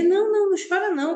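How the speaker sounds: high, loud and fast, rushing to comfort; urgent reassurance. Acted. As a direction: hurry to stop her crying. Comforting crying friend, urgently.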